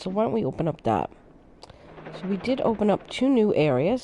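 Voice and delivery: ordinary talk, nothing marked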